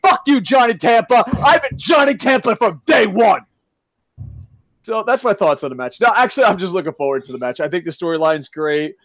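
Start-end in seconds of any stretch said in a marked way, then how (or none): 0.0-3.4 furious shout. Real, not acted. Podcaster yelling at the wrestler who complained, furious shout. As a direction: bellow it straight at him.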